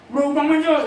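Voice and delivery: shouted high and loud; angry king's order. Shown as a demonstration. King Ahab ordering the prophet jailed, shouting. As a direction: bark the order at the guards. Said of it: "이렇게 얘기하면 돼요".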